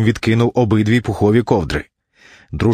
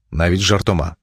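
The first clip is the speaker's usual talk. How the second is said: ordinary delivery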